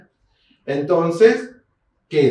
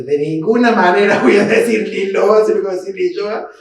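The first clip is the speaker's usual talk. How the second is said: mock indignant colleague; 5 semitones higher, 6 dB louder than usual. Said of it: "lo que debe estar pensando Miriam Catán".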